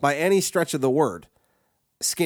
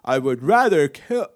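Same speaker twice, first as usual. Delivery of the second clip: melodramatic complainer. Retold or imitated. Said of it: "you talk all that shit about".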